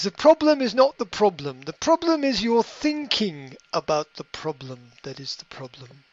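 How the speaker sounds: put-on a light London accent as the drunken pirate; Johnny Depp's pirate, done in a light London accent. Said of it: "He talks like he's from London".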